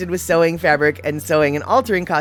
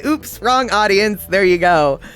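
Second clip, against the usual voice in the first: ordinary delivery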